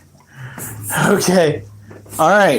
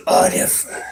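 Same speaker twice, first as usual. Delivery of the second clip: whispered name; 5 dB quieter than usual. Real, not acted. Softly calling the dog.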